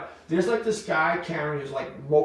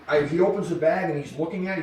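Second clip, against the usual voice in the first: ordinary delivery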